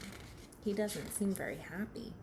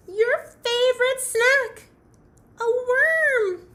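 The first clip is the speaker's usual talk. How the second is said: very high, loud, stretched-out words; thrilled, squealing Fox; excited announcement; 13 semitones higher, 13 dB louder, slower than usual. Acted. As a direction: proclaim the find triumphantly. Fox excitedly announces a found worm.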